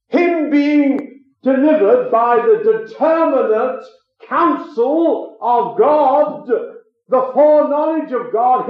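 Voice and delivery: slow emphatic. Acted. Preacher slows scripture phrase.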